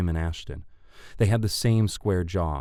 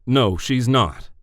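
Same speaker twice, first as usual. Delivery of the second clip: put-on slow, loud southern-accented objection; higher, louder southern-accented voice; 6 semitones higher, 7 dB louder, slower than usual. A narrator's reading. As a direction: cut in firmly with a southern twang. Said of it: "a voice laced with a southern accent".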